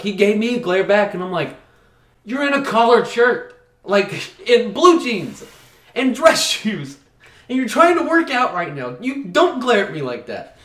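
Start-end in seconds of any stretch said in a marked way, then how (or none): 2.2-10.6 mock indignant rant. Scolding the gym guy in work clothes, mock outraged. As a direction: tear into him, outraged.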